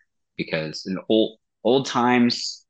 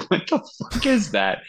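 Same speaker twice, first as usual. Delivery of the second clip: high-pitched baffled reaction, retold; mock disbelief; 11 semitones higher than usual. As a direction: replay your bafflement, pitched way up. Repeated reaction, amused by his retelling.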